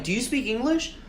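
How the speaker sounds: high, rising exasperated question; pointed questioning. Retold or imitated. Driver questions silent car owner, exasperated rising question. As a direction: press for any answer at all.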